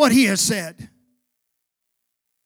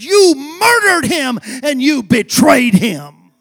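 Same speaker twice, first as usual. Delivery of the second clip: slow, louder accusation with a cry in it; accusing shout; 8 dB louder than usual. Acted. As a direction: point the finger and condemn them. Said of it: "he points his finger at them and said".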